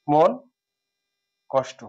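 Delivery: careful teacher demo of মন কষ্ট; slow split pronunciation. Shown as a demonstration. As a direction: say মন and কষ্ট as two separate, slow words. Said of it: "মন কষ্ট এভাবে বসবে".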